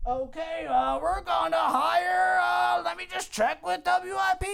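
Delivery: high, slow blustery boss voice; pondering boss. Acted. Boss about to announce a hire, stalling loftily. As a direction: drag it out, high and self-important.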